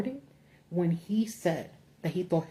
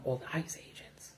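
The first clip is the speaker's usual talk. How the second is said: whisper; 4 semitones lower, 6 dB quieter than usual. Real, not acted. Trails into whisper.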